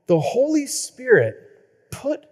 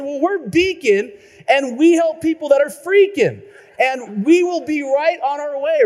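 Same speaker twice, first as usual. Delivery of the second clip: reassuring receptionist; 7 semitones higher, 3 dB louder than usual. Retold or imitated. Company reassuring caller.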